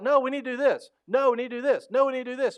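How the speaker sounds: loud, high, insistent repetition; teenage disciples arguing back, mock loud and stubborn; stubborn teen protest. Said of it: "And they're like".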